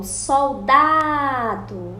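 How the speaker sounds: "soldado" slow, with DA held long; "soldado" with a drawn-out DA; stretched stressed syllable. Shown as a demonstration. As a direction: linger loudly on the middle syllable of "soldado". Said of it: "sílaba forte que está no meio".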